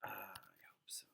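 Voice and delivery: whispered aside. Real, not acted. Teacher whispers a doubtful aside to the class. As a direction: breathe it out under your breath. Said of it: "whispered aside after sigh".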